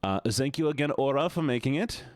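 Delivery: ordinary talk, nothing marked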